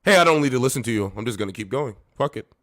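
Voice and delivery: loud, fast, careless mock driver; mock reckless driver brushing off a cop; mock defiant driver. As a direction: toss it off like you'd ignore the cop. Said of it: "would I say something like this".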